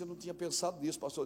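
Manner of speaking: very soft, low chastened voice; meek retold reply. Chastened church member admitting it to pastor, meek and hushed. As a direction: drop right down, small and sheepish.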